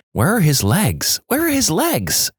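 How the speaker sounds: lightly voiced panicked nurse, pitch leaping on the repeat; slow, increasingly high fretting; a touch of panic, drawn out and climbing; panicked muttering. A narrator's reading. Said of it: "repeatedly muttering".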